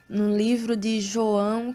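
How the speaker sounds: ordinary delivery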